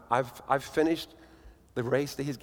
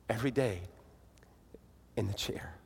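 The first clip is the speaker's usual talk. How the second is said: breathy whisper for the key phrase; hushed whisper; 4 semitones lower, 8 dB quieter than usual. Acted. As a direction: drop to a whisper to land the point. Ending story on a hushed note.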